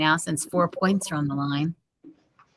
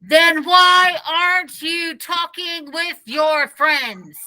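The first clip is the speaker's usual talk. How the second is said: shouted; 9 semitones higher, slower than usual. Acted. Principal stepping closer to Dave, slow high shout. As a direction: bear down on him and shout. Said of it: "and shouted".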